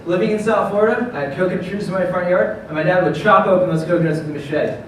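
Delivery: upbeat rehearsed sales pitch, projected; confident startup pitch. Acted. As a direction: sell it to investors with energy. Delivers rehearsed pitch to judges.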